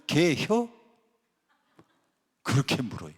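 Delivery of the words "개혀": clipped-word Chungcheong question, pitched up; Chungcheong dialect. Shown as a demonstration. Preacher showing how Chungcheong people ask about dog soup, Chungcheong dialect. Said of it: "충청도 사람들은요".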